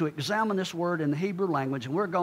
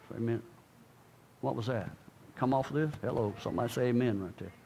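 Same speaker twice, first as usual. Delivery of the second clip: much lower and softer, off-script; muttered aside; 9 semitones lower, 9 dB quieter, faster than usual. Real, not acted. Preacher breaking off to ask what that was, muttered aside. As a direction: drop right down into an offhand aside.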